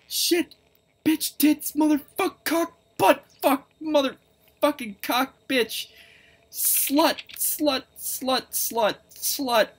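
very high-pitched, frightened string of swearwords; high, loud, scared swearing; panicked cursing. Real, not acted. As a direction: blurt out curses in shock. Swearing right after jump scare.

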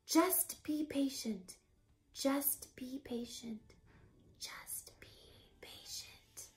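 whispered mantra dying to nothing; fading whisper. Acted. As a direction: repeat it, fading away.

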